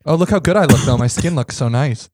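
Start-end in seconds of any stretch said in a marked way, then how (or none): none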